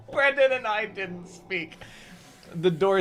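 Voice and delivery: reading through laughter; giggling while reading aloud; laughing. Real, not acted. Reader cracks up mid-line.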